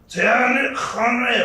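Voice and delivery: hammed-up, throaty French pronunciation; overly French accent. Comedian mocking his friend's pronunciation of a footballer, overly French accent. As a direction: do a wildly exaggerated French accent. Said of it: "most overly French accent ever".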